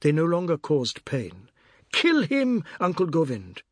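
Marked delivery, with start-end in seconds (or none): 1.9-3.6 high cry that drops on the name; high-pitched egging on; shouting. A narrator's reading. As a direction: shout the command high, then ease off. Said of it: "kept on shouting".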